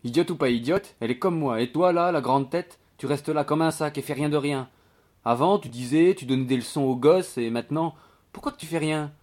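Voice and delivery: cheeky, reproachful servant voice; scolding maid. Acted. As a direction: have a go at the lazy lodger. Voices maid berating idle lodger.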